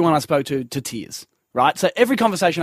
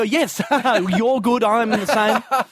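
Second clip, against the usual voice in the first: fake agreement; 5 semitones higher, much slower than usual. Acting polite false agreement.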